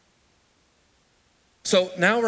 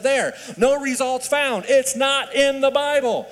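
slow, higher, louder "There, no results found", then "It's not in the Bible"; triumphant gotcha; 9 semitones higher, 4 dB louder, slower than usual. Retold or imitated. The college son to his mom, showing the search, slow triumphant proof. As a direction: prove her wrong with smug certainty. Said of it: "and I said".

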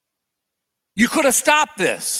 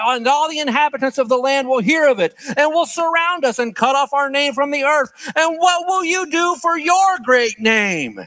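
impassioned plea; 7 semitones higher than usual. Acted. Joshua pleading with God after defeat, impassioned. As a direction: build the plea until it breaks open. Joshua's prayer read with rising force.

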